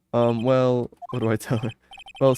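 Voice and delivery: ordinary talk, nothing marked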